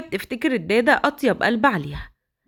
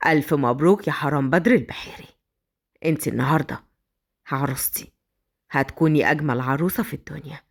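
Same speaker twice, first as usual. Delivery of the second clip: menacing kidnapper; 8 semitones lower than usual. Acted. Badr threatening the captive girl, low and smug. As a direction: savour his power over her.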